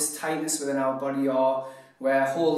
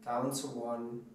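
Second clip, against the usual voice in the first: hushed, unhurried guide into deep relaxation; guided meditation; 3 semitones lower, 13 dB quieter than usual. Acted. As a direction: talk them softly through a body scan, letting each phrase settle. Leads relaxation body scan.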